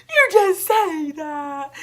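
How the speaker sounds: high sobbing whine, very slow and loud; crying. Retold or imitated. To her reassuring boyfriend, a slow tearful sob. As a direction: wail it out through tears.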